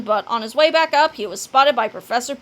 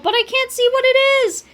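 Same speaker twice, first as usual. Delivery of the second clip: high, urgent bewilderment; alarmed cry; 12 semitones higher, 4 dB louder than usual. A narrator's reading. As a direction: cry it out, baffled and frantic. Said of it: "cried Cheeseball".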